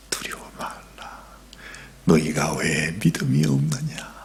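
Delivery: low, soft whisper; hushed gentle reproach; whispered rebuke. Acted. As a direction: quietly chide their fear. Jesus rises and rebukes gently.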